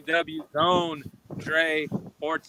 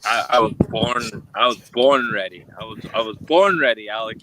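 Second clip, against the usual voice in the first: lower, louder boast, repeated with growing force; pumped up; 6 semitones lower, 6 dB louder than usual. Acted. [name] answering the host, a loud, lower "born ready" boast. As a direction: hype yourself up before the match. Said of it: "I think [name]'s excited".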